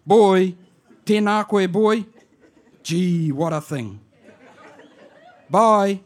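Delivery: put-on high, loud Māori auntie calling out to her boy, amused; auntie on the answer phone. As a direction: shout down the phone like a bewildered old auntie.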